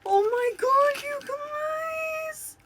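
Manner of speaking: high, loud squeal of delight; real overjoyed shriek. Real, not acted. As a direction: squeal at a long-hoped-for surprise. Finds the toy they hoped for.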